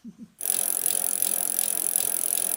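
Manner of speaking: breathy delighted laughter; nanny laughing freely on the bicycle; gleeful laugh. Acted. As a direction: laugh with the joy of the ride. Miss Biscuit enjoying the bike ride.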